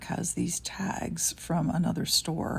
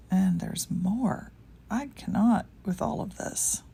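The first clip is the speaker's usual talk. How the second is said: usual speaking voice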